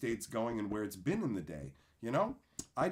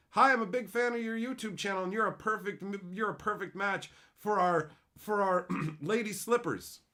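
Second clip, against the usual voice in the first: bright, higher put-on sales voice; hammed-up spammy fan email; mock spam email voice; 6 semitones higher, 3 dB louder than usual. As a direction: read it like a fake fan pitch.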